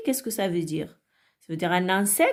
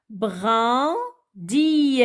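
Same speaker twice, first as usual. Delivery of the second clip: syllable by syllable. Shown as a demonstration. Teacher cutting words up for children, brun - dit spaced out. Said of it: "découper les mots en syllabes".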